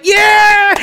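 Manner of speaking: high, loud drawn-out cheer; crowd cheer. Retold or imitated. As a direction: shout it like a whole crowd cheering. Crowd cheers as bar locks.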